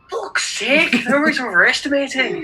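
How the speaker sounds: a bad high Irish accent. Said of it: "try a bloody Irish accent".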